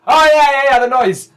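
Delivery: high, shouted agreement; oblivious old husband, far too loud; loud deaf reply. Acted. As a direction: holler back as if wearing earplugs. Earplugged husband answers loudly.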